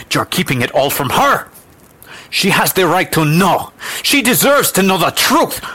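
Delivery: high, loud, building outrage; impassioned demand. Acted. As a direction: fight for the child, building to a shout. Woman demands truth for girl.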